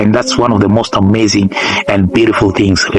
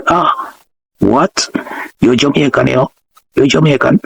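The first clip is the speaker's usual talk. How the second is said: imitated Jamaican patois; 4 semitones higher than usual. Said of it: "they switch up Jamaican patois".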